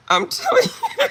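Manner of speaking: squeaky words through laughter; laughing. Real, not acted. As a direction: talk while cracking up.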